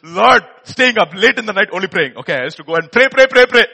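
loud, anxious praying cry; desperate pleading. Retold or imitated. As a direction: beg heaven loudly. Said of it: "and I'm like".

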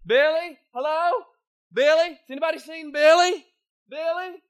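high, loud calls into the mine; a mother calling loudly for her son in the dark. Acted. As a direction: shout for him through the tunnels.

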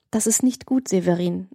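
plain reading voice

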